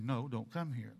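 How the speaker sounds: soft, low, gentle "No, don't come here". Shown as a demonstration. Preacher redoing God's no gently for the congregation. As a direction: say "No, don't come here" kindly, like a caring father. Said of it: "That sounds better".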